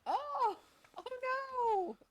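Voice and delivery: high yelp of alarm; startled. Real, not acted. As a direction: cry out in shock. Reacts to game scene.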